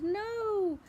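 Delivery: high, loud alarmed cry. Acted. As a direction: shout it in a panic. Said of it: "cried the witch".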